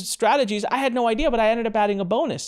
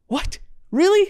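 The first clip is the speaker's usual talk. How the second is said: gasp, then a high, loud, delighted "Really?"; breathless disbelief jumping up into delight; retold gasp of surprise; 13 semitones higher, 3 dB louder than usual. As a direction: relive the shock of being offered more than you hoped. Retelling a surprise offer of five hundred.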